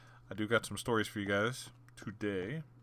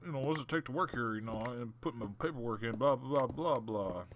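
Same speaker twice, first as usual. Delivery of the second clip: joking mock voice; 3 semitones higher than usual.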